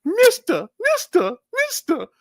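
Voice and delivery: put-on very high, loud old lady's calls; high old woman calling mister. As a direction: squeak it up high like a frail old woman pleading. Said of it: "She's like".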